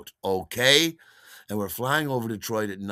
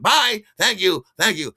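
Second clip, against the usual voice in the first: high, loud and sing-song bye while rushing out; chirpy farewell; 10 semitones higher, 10 dB louder than usual. Retold or imitated. First off the plane, he sings a high loud bye to the crew. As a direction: toss a bright goodbye on the way out.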